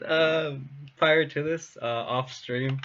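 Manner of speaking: usual speaking voice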